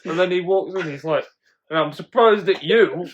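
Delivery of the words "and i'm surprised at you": mock indignant scolding, louder; retold telling-off. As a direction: imitate a drunk dad going off on one. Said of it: "he's, like, going off on one".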